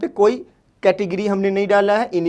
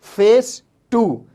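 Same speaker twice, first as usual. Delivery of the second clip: फेस टू said slowly, word by word; slowly repeated; 2 semitones higher, much slower than usual. Shown as a demonstration. Teacher repeats the English slowly for the class. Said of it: "English में हम कहते हैं".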